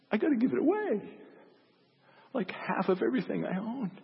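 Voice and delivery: very high, halting, tearful confession; near tears. Acted. Little tax man vows to Jesus to repay everyone, near tears. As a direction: blurt it out haltingly, close to crying. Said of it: "He was near tears".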